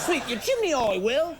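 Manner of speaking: high hammed-up Cockney chimney sweep; Cockney accent, jokey and sing-song; mock Cockney accent. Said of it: "my chitty chitty bang bang accent".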